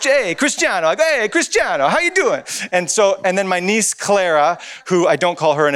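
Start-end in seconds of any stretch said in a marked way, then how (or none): 0.0-0.9 put-on high Italian-accented greeting; playful Italian accent. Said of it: "who I call Cristiano because my wife's part Italian". 1.0-2.4 put-on high, sing-out Italian-accented greeting; playful Italian accent. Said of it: "who I call Cristiano because my wife's part Italian".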